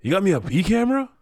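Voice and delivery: excited director voice; lightly voiced eager director.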